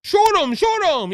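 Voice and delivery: high, loud mock Cajun accent; hammed-up Cajun exclamation; Kamala Harris. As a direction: yelp it in fake Cajun. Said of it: "try to make up a Cajun accent".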